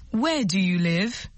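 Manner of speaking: slow, clear question for learners; slow model phrase. Shown as a demonstration. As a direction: spell out each word slowly. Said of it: "Où habitez-vous?".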